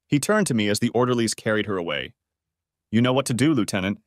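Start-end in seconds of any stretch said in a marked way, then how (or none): none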